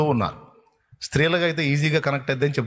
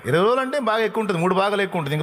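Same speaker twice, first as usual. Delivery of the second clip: rushed, much higher excited uncle; worked-up uncle; 7 semitones higher, much faster than usual. Acted. Uncle scoffs at the twenty-day dose, fast and high.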